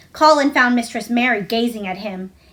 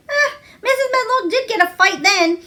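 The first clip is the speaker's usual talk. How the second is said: higher, relishing the drama; gleeful exclamation; 9 semitones higher than usual. A narrator's reading. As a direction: savour the gossip with glee. Martha relishing the gossip.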